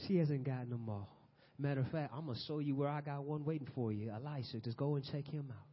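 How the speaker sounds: soft, casual aside as God; hushed, matter-of-fact reassurance; mock divine whisper. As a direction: quietly let him in on it. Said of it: "God whispers back to him and says".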